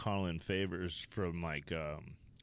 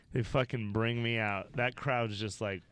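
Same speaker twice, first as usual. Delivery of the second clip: ordinary talk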